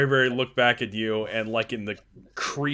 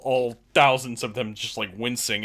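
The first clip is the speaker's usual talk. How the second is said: usual speaking voice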